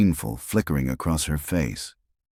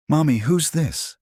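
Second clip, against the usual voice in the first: put-on little girl voice; 12 semitones higher than usual. A narrator's reading. Little girl asks her mother.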